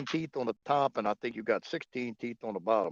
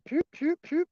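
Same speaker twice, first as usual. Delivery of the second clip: pew pew sound effect. Retold or imitated. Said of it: "it's just like".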